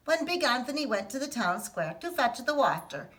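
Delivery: ordinary delivery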